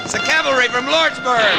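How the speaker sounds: high, ringing shout of relief; excited shout. Acted. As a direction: cry out the good news to all. Rescue arriving during Apache attack.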